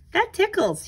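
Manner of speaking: high, giggly exclaim; tickled. Acted. Boy with butterfly on his nose, high and tickled. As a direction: say it as if something tickles.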